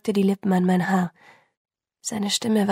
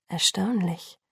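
wondering, amused; 2 semitones lower, 5 dB quieter, slower than usual. Acted. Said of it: "klang verwundert und gleichzeitig ein wenig belustigt".

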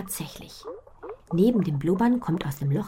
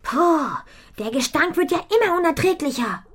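put-on high, loud girl's voice, grossed out by the stench; disgusted girl; 13 semitones higher, 9 dB louder than usual. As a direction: wrinkle your nose at the stink. Mädchen ekelt sich vor Gestank. (angeekelt, hohe Mädchenstimme)

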